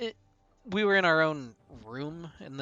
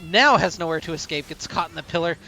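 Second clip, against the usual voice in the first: upbeat, louder esports cast; play-by-play casting; 3 semitones higher, 7 dB louder than usual. Acted. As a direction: call the fight with rising energy. Excited play-by-play of a fight.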